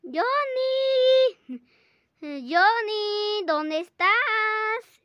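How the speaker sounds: high, loud call, drawn out slow. Pretend. Kid's toy searches and calls for lost Johnny, high loud call. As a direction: call across the room for a lost friend.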